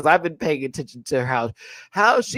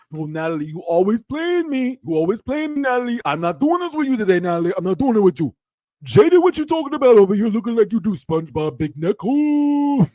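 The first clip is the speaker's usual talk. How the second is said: animated mock quarrel in a put-on accent; fast, exasperated impersonation; Biggie impression; 4 semitones higher, 4 dB louder than usual. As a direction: imitate a reality-show star fed up and arguing. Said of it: "This would have been Biggie".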